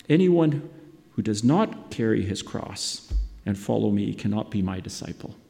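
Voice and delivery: normal talking voice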